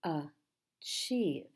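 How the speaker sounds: "Achieve" split as a, chieve with a gap; teacher sounding out "Achieve" in two beats; syllable by syllable. Shown as a demonstration. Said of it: "How many syllables in this word?".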